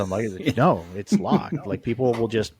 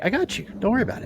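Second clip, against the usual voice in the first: high confident reassurance; 9 semitones higher, much faster than usual. Retold or imitated. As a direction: voice jumps up, cocky. Reassuring buddy.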